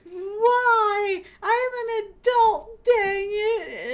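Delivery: put-on high, loud childish whine; whiny protest. Retelling protest to friends hiding vodka, loud whiny wail. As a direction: whine like a kid denied a treat. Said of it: "And I said".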